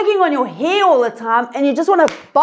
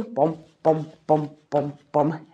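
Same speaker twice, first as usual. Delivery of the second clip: walking sounds; 10 semitones lower, 7 dB quieter than usual. Acted.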